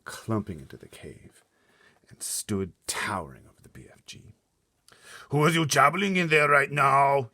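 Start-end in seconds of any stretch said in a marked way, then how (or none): 5.3-7.3 huge loud booming giant voice. Acted. Blood Bottler challenging the BFG, booming. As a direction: boom it like a monster. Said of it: "he boomed".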